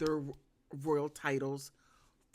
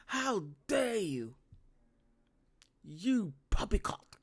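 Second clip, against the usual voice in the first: mock-outraged British accent, lightly done; bad British accent; 2 semitones higher than usual. Vlogger mocking William in a bad British accent. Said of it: "I have to work on my British accent".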